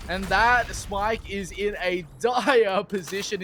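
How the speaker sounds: fast, high play-by-play; esports caster. Acted. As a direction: rattle off the action.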